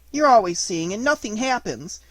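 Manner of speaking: usual speaking voice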